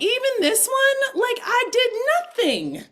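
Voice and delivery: indignant outburst. Retold or imitated. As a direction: protest high and loud like you were wronged. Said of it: "I didn't get mad in that moment".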